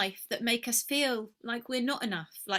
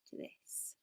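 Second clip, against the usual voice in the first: whisper; 18 dB quieter than usual. Shown as a demonstration. Demonstrating power shrinking.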